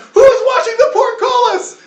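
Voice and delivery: very high, loud panicked guard yell; shouted question. Acted. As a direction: holler it across the yard as a guard. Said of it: "somebody else shouts".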